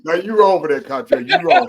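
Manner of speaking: loud, laughing "you wrong"; laughing protest. Real, not acted. Host laughing back at Cottrell's tease about his crying. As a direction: push back through laughter.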